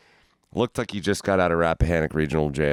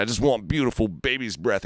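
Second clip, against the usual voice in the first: higher, pleading indignation; mock offended; 5 semitones higher than usual. Imitating the customer insisting on his tattoo, higher and offended. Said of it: "he's, like, getting all offended".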